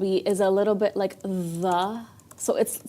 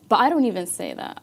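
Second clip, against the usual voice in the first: usual speaking voice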